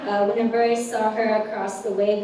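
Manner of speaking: ordinary talk, nothing marked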